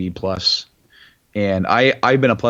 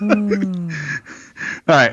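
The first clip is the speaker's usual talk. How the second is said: Bane voice; 8 semitones higher than usual. Acted. Still in the Bane voice.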